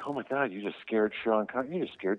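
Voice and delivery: high-pitched, appalled mock outburst; incredulous reproach. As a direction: scold your brother in astonishment. Retold reaction to brother scaring star.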